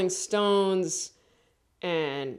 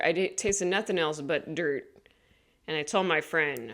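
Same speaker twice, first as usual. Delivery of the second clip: ordinary talk, nothing marked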